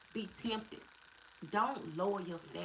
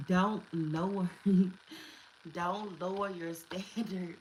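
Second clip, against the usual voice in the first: God's warning, laughing; 6 dB louder, slower than usual. Retold or imitated. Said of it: "But God is saying".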